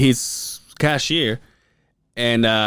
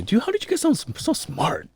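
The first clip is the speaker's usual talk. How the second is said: high admiring voice; 11 semitones higher, 5 dB quieter than usual. Retold or imitated. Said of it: "I used to say".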